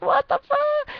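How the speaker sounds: very high shocked what the fuck; high incredulous squeal; shocked squeak. Retold or imitated. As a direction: jump up into a squeal of disbelief. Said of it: "I was like".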